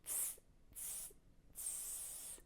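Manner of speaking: teasing monkey "tss" hisses; three soft voiceless hisses, last one drawn out; monkey chatter. Shown as a demonstration. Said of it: "Can you do it like they did?".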